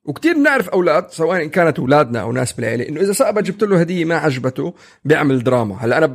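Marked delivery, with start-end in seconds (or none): none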